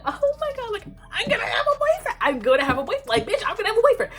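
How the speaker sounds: very high, fast, loud gushing; giddy squeal. Retold or imitated. Freshman girl thrilled about her first boyfriend, high giddy squeal. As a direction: squeal it like a lovestruck teen.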